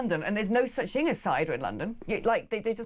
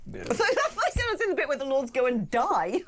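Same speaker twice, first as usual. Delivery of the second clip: higher-pitched, laughing through the words; 6 semitones higher than usual. Real, not acted. Podcast host teasing guest about the Lords, laughing. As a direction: crack up while saying it. Cracking up at misheard word.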